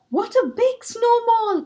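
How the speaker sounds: high, loud cry of wonder; excited cry. Acted. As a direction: marvel at the snowball aloud. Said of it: "cried Squirrel".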